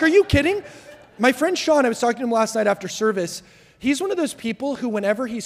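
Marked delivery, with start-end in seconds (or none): 0.0-0.6 high, loud disbelief; can't believe what he just heard; a startled, loud are you kidding; incredulous. Retold or imitated. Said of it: "I'm like".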